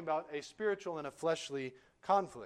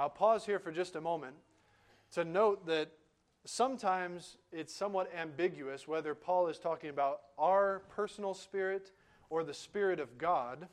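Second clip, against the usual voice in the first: ordinary talk, nothing marked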